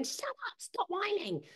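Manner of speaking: high, sharp mock snap at the kids; a mild mock yell; mock snapping. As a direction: imitate a cross parent snapping. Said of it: "I'm not going".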